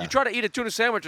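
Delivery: normal talking voice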